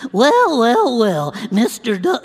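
loud sitcom character impression; hammy character voice; Mister Haney. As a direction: put on a comic TV huckster voice, starting high and loud. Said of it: "do Mister, Mister Haney from Green Acres".

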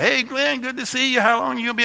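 high-pitched friendly neighbour greeting. Retold or imitated. Neighbour greeting the pastor pulling up, imitated high voice. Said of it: "he's like".

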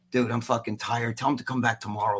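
imitating a worn-out star, low and quiet; tired brush-off. Host mimics Flex refusing the kid, low tired mumble.

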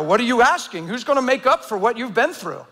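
ordinary talk, nothing marked

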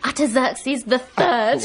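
slow, laughing mock proclamation; teasing, drawn-out imitation; mimicking the vicar. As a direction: echo the preacher's triumph through laughter. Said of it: "you'll be exactly like that someday".

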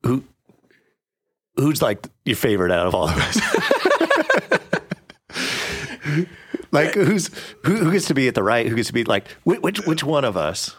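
high, quick, eager questioning; eager disciples. Retold or imitated. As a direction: pester like needy kids. Disciples voiced asking favorite.

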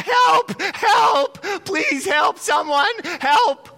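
desperate high shouts for help; man hanging over a canyon yelling for help; calling for help. Acted. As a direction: scream for help, terrified. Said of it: "he starts going".

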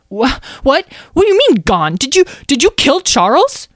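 high, loud, stammering disbelief; horrified mouse. Acted. Mouse learns Charles is gone, horrified. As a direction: burst out in a shocked creature voice.

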